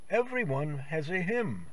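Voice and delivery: usual speaking voice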